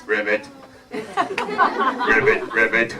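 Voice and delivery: low-pitched frog ribbit; frog croak. Acted. Frog answers the fairy.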